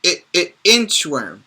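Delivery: "I, I, inchworm" with a clipped short i; teacher sounding out the first letter. Shown as a demonstration. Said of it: "What if I say inchworm?".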